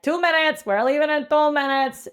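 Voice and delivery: high-pitched mock departure call; boat captain calling out. Captain warning passengers of departure, high mock call. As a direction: announce it like a captain hurrying passengers. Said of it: "the captain of the boat's like".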